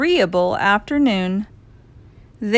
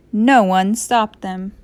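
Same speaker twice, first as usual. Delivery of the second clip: usual speaking voice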